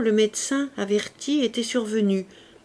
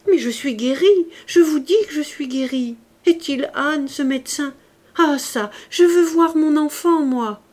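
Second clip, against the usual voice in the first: higher, louder impetuous protest; impetuous outburst; 7 semitones higher, 7 dB louder than usual. A narrator's reading. Fantine interrupting the doctor, demanding her child, impetuous outburst. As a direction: cut him off, insisting hotly. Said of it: "Elle l'interrompit impétueusement".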